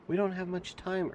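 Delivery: high, urgent plea; urging. A narrator's reading. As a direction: press him, pitch rising high. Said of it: "urged Catherine".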